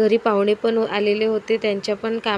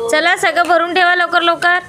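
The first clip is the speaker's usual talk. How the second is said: high, loud brisk urging; 6 semitones higher, 4 dB louder than usual. Real, not acted. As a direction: chivvy her along to dinner. Mother hurrying child to eat.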